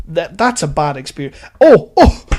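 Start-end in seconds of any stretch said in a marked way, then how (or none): 1.6-2.2 loud, high burst of realisation; sudden remembering. Real, not acted. Interrupting himself as a forgotten story comes back, sudden exclamation. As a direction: jump in as if it just hit you.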